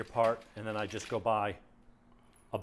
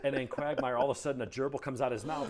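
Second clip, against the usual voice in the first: normal talking voice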